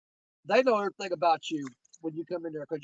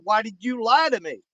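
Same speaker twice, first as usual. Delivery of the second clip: a mild mock yell at the clerk, louder; retold yelling; 3 semitones higher, 7 dB louder than usual. Retelling how he yelled at the hotel clerk, raised voice. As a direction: confront her, indignant and raised. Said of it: "why are you yelling at me".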